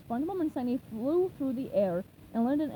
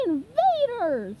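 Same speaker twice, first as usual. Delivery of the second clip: much higher, louder, slow yell; 10 semitones higher, 7 dB louder, much slower than usual. A narrator's reading. Big brother spots intruders in sandcastle, loud slow yell. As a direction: bellow the alarm. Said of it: "he yelled".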